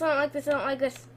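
fast anxious run-on muttering; nervous babble. Real, not acted. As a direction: rattle it off fast, dropping in volume. Nervous repetition in game.

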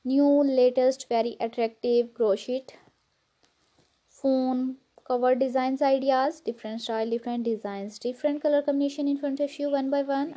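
Pakistani English, warm vlog greeting; pakistani accent. Real, not acted. Whole vlog in own accent.

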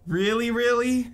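mock excited. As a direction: imitate a thrilled little girl. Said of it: "She got really excited".

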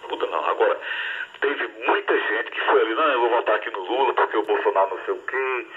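ordinary talk, nothing marked

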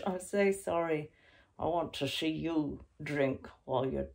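ordinary delivery

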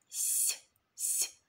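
с, с hissed voiceless and soft; hissed sound. Shown as a demonstration. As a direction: hiss the с twice. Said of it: "м'який".

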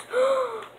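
sharp, very high gasp of delight; high thrilled intake of breath; excited gasp. Real, not acted. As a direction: gasp at spotting a favorite gun. Finding favorite weapon.

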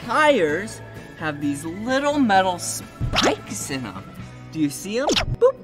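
normal talking voice

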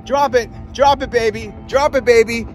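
ordinary delivery

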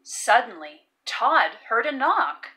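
ordinary delivery

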